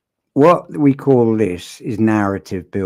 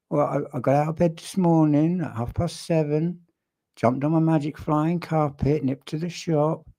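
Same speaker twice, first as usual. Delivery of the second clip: higher-pitched uptalk, lifting "morning", "seven", "carpet" and "shop"; mock upward inflection; 5 semitones higher than usual. Shown as a demonstration. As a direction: tell a tall tale with "seven" and "shop" rising like questions. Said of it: "talks with the voice going upwards at the end".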